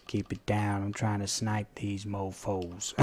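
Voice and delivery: slow, quiet imitated Texan; mock Texan sniper hushing you; Texan accent. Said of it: "Texan kind of accent".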